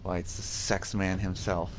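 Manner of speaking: ordinary talk, nothing marked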